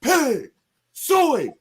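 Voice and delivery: high, loud Razorback hog-calling chant. Acted. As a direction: call the hogs at full voice, way up high. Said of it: "you know what I'm gonna do".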